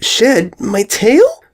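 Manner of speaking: high, slow startled squeak; shocked little filly voice. A narrator's reading. Filly hearing she will shed her tail, squeaking. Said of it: "Sweetie squeaked".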